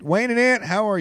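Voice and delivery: hype intro call. Shown as a demonstration. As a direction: announce them like a showman. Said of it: "who writes your intros".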